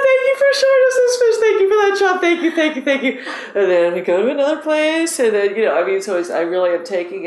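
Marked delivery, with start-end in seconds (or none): 0.1-3.2 high, loud whoops of thanks, tumbling out; elated thank-you. Retold or imitated. Angler thanking the sky for a fish, elated and high-pitched. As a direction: cry out thanks to the heavens, sky-high and joyful. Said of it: "I look up and I go".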